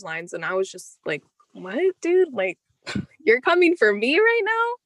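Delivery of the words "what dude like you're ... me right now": incredulous retort. Retold or imitated. Retelling own reaction to teacher.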